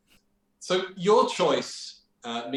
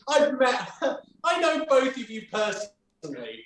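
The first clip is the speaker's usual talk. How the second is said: high-pitched laughing protest; 7 semitones higher than usual. Real, not acted. Host calling out guests they know personally, laughing. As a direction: tease them through helpless laughter.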